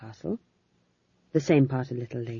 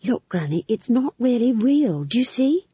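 higher, anxious reasoning; pleading explanation; 8 semitones higher, 4 dB louder than usual. A narrator's reading. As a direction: reason with an angry elder. Pleading with the angry old witch.

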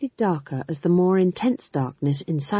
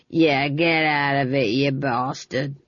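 low, slow gruff grumble; gruff shooing voice; 3 semitones lower, slower than usual. Acted. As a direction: shoo the cat off with a gruff growl. Castle folk shooing the cat.

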